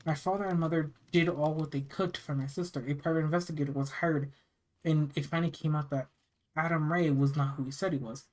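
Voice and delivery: usual speaking voice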